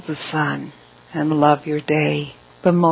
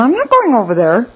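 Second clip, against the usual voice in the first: high-pitched retold refusal; put-on high voice, refusing to go; mock refusal; 7 semitones higher, 4 dB louder than usual. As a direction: imitate someone balking, pitch jumping up. Acts out Terry's refusal to go.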